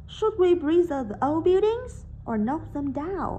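loud, slow, up on "buildings", down on "knock them down"; correct choice intonation. Shown as a demonstration. Teacher rereads the correct first sentence for the class. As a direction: rise to "buildings", fall after "or". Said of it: "Câu thứ nhất chúng ta sẽ đọc lại như sau".

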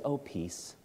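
very soft, tender greeting; gentle Jesus. Acted. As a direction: let the peace fall quietly. Jesus voiced in retelling.

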